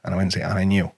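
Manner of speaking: ordinary talk, nothing marked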